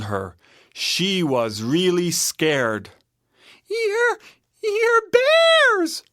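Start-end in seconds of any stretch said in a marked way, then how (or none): 3.7-6.0 put-on very high, halting little-girl voice; timid Goldilocks. A narrator's reading. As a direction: stammer it out in fright. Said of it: "she said timidly".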